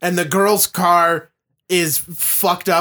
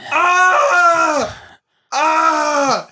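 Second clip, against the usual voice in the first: mock scream; 8 semitones higher than usual. Mocking the woman on the tracks, mock scream.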